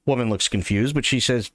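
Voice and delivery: normal talking voice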